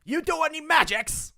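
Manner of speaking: high, loud goblin croak. Acted. Goblin demanding of the captive party. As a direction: squeak it out like a pushy goblin.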